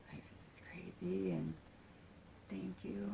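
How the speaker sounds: very soft, near-whispered thanks; hushed gratitude. Retold or imitated. Thinking thanks while she holds the speaker, hushed gratitude. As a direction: murmur it like a private prayer of thanks. Retelling grateful inner thought while held.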